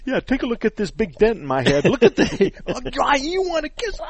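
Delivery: high-pitched, loud, laughing retelling; laughing through it. Retold or imitated. Husband laughing as he retells his answer to his wife's offer, laughing, high and loud. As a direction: crack up while quoting yourself.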